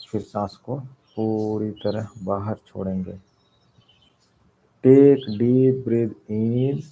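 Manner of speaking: slow, drawn-out breathing cues with long pauses; guided breathing meditation. Real, not acted. Leads deep breathing meditation.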